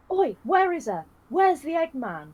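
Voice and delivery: loud, high, slow mock yell as a boss; hammed-up bellow, drawn out; mock shout. Mark Darrow yelling at the narrator at work, hammed-up loud shout. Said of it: "he's like".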